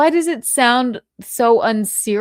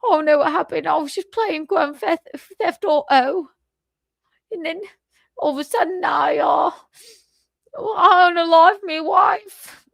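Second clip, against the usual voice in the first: mock British accent; 8 semitones higher, 3 dB louder than usual. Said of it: "a British accent".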